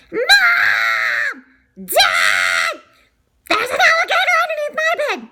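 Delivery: put-on loud frantic child's call. Scared child calling mom and dad, loud frantic yelling. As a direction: yell for the parents, fast.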